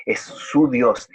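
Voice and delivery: "su" stretched in a slow "es su Dios"; slow down and press on "su"; stress on su. Shown as a demonstration. Preacher to congregation, pressing "su" slowly. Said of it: "no es simplemente Dios en un sentido lejano".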